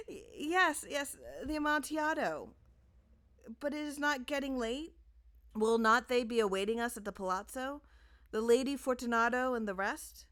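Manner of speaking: high, strained voice of a trapped man; Fortunato's pleading jest. A narrator's reading. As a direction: feign cheer while begging to leave. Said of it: "a sad voice".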